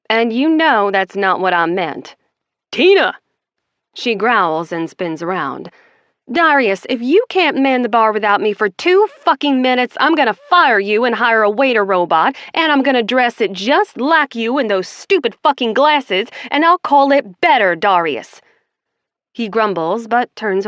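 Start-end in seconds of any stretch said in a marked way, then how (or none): 2.7-3.2 sharp loud call across the bar. Acted. Friend calling the bartender loudly. Someone calls her name sharply. 6.3-18.4 loud, higher furious tirade; angry rant. Acted. Boss yelling at her bartender, furious.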